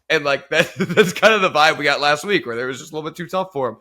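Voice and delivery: laughing while talking. Real, not acted.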